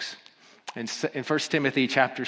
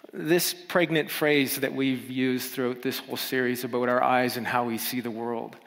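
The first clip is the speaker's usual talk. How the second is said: ordinary talk, nothing marked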